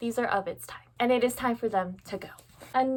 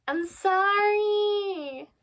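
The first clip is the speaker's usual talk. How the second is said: high, long-drawn-out sorry; sheepish apology; 10 semitones higher, much slower than usual. Real, not acted. Book owner owning up to never reading a gift, sheepish. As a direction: stretch the apology into a high guilty whine.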